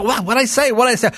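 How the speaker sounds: lightly voiced bewildered boy; innocent little boy. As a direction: play the puzzled child. Innocent boy asking back.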